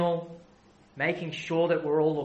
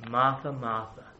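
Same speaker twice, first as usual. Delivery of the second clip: caring and compassionate; 4 semitones lower than usual. Acted. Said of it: "but care and compassion".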